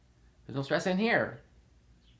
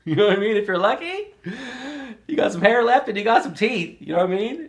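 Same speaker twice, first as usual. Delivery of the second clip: amused; 7 semitones higher, 7 dB louder than usual. Real, not acted. Joking about hair and teeth.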